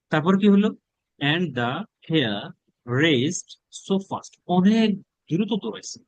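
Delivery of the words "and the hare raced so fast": slow read-aloud. Shown as a demonstration. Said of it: "teacher reading slowly".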